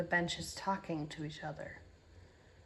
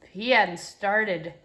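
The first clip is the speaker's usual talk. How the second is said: loud, slow, insistent objection; defensive protest; 10 dB louder, slower than usual. Acted. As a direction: push back hard at the accusation. Protests under detective's pressing.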